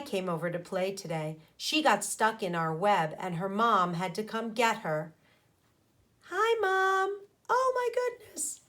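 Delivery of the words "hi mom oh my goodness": squeaky high greeting, pitched well up; a small child politely greeting her mom; high little voice. A narrator's reading. Child greets her mom in a high squeak.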